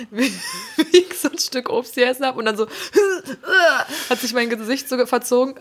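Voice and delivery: giggling through the words; laughing through the story; laughing while talking. Real, not acted.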